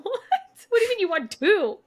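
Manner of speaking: what do you mean, two, through a laugh; incredulous. Retold or imitated. S0 retelling Kingfisher's shock at the girl's greed, laughing disbelief.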